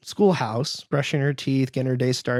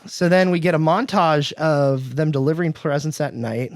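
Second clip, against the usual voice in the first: normal talking voice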